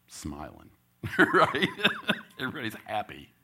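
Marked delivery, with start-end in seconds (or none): 1.2-2.3 bursts of high, loud laughter. Real, not acted. Coach laughing at his own story, high bursts. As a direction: crack up at the memory.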